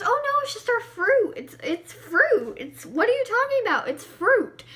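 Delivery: high, loud over-insistent denial; feigned innocence. Retold or imitated. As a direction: protest like a kid caught fibbing. Kids lying to parents about bones.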